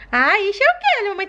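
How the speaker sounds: put-on high little toddler voice; a small child talking to her mom; baby doll voice. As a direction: play the sick baby begging to play.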